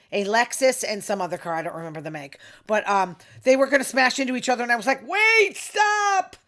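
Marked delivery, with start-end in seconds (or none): 5.1-6.4 high, loud call to halt; re-enacted warning shout. Retelling how she stopped a crash, urgent warning shout. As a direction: call out as if stopping two cars.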